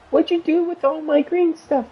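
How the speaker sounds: high, indignant sister voice; mock sister. Sister demanding where her green stuff went, mock high whine. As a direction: squeak it up like an accusing sister. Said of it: "my sister's like".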